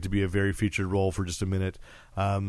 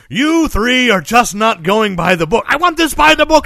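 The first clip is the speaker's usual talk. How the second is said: commander barking orders; 12 semitones higher, 12 dB louder than usual. Retold or imitated. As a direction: bellow like a TV cop-show captain. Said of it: "the commander of the Musketeers is gonna call them in and go".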